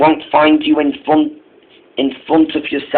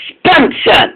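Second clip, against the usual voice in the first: higher, a touch of irritated scolding; mildly annoyed; 14 semitones higher than usual. Acted. Duke and Duchess scolding late Spencer, mildly annoyed. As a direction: call his name as a light reproach. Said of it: "The Duke and Duchess were annoyed".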